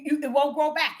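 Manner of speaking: usual speaking voice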